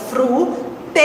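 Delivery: "Fruteira" said as fru, tei, ra; three separate syllables. Shown as a demonstration. Teacher showing the three syllables, separated "Fruteira". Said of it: "já separei em três sílabas".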